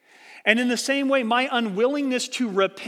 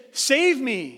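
mild mock yell, a little higher; yelling for help; 4 semitones higher than usual. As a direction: call out for rescue, half-acted. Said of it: "you're yelling at him".

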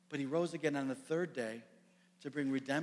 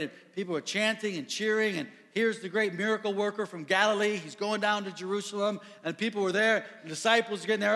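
building excitement; 5 semitones higher, 6 dB louder than usual. Acted. Describing noisy cheering parade.

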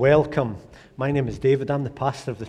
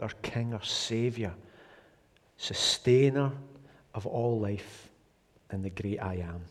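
soft, lowered praying voice; prayer; 4 semitones lower, 9 dB quieter than usual. Real, not acted. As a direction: pray quietly to the Father, pitch dropped. Pastor leads a prayer.

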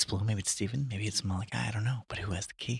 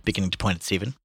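ordinary delivery, nothing marked

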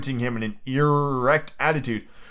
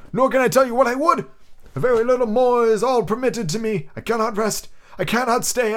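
ghost voice; 10 semitones higher than usual. Acted. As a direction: intone it like a chained spirit. Ghost Marley speaks.

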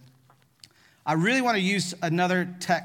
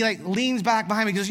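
ordinary delivery

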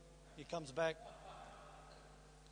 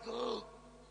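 sharp mock gasp of alarm; shocked gasp; 4 semitones higher, 3 dB quieter than usual. Preacher telling the congregation about the father, sharp gasp. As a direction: gasp as if the news just hit.

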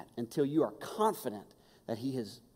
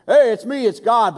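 loud, high mock booming voice; booming God; 8 semitones higher, 13 dB louder than usual. Preacher mocking a booming God voice, loud. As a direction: boom it out like a giant. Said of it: "big booming voice".

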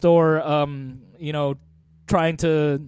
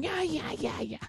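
high, soft German-voiced chant; Kevin Smith; 8 semitones higher, 13 dB quieter than usual. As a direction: squeak faintly like a tiny comic German. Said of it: "you hear Kevin Smith going".

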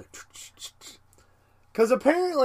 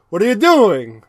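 put-on light Mexican accent, loud; 2 semitones higher, 7 dB louder than usual. Zoidberg confronts the doctor, mild Mexican accent. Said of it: "I gave him a Mexican accent".

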